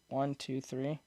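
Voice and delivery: soft counting at low level; quiet level. Shown as a demonstration. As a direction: count it softly under your breath. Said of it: "talking at a level like this".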